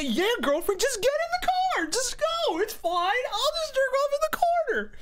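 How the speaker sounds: very high, hammed-up squeaky surrender; mock pushover boyfriend. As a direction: squeal a sarcastic imitation of an overeager doormat.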